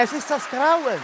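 mock horror. Dramatisches Grauen.

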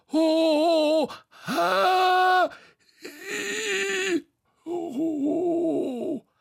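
rising, strained squeals and whoops; climbing effort cries. Acted. Bo clambering up Tete, high effortful squeals.

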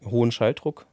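ordinary delivery

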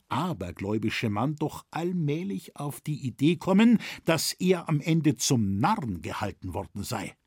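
ordinary delivery

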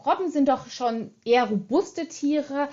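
ordinary talk, nothing marked

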